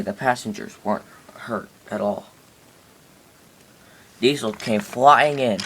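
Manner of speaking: plain reading voice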